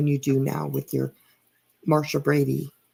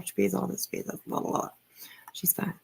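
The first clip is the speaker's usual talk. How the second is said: dismissive mumble; 8 dB quieter than usual. Retold or imitated. Dismissive mock summary.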